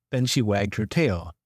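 usual speaking voice

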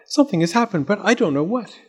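hint of a puzzled, worried girl; lightly voiced worried Zenobia. A narrator's reading. Zenobia tells Fletcher something has happened, mildly alarmed. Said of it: "she cried".

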